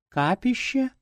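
high, hushed surprised question; surprised doll. A narrator's reading. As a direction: gasp it out in surprise. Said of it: "удивилась кукла".

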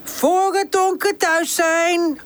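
high, slow, mock-strict aunt; silly aunt voice. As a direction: put on a silly bossy auntie voice. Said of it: "Pip zet een gek stemmetje op".

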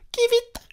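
very high happy bird chirp; joyful chirp. A narrator's reading. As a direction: chirp it gladly like a swallow. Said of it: "ses joyeux". (gazouillis joyeux très aigu)

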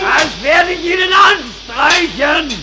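slow loud high threat; angry shout. Acted. (wütend drohend)

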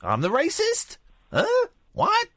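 usual speaking voice

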